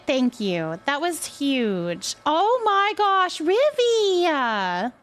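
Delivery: ordinary talk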